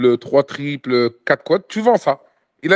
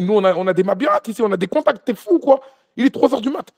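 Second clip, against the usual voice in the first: exasperated scolding; 7 semitones higher than usual. Retold or imitated. Guide scolding the brother at 3am, high and frantic. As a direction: berate him, panicked and high.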